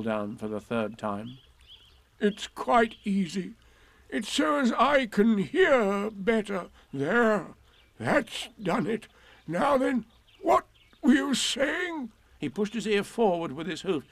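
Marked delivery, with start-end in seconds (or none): 2.2-12.1 slow, effortful donkey, climbing higher; Eeyore. A narrator's reading. Eeyore balances hoof to ear to hear, slow and effortful. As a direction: labour through it slowly, rising to the question.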